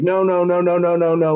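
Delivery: high, pleading "no, no, no"; a cowed wrestler's high, begging "no, no, no"; Ric Flair begging. Retold or imitated. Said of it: "his best Ric Flair imitation".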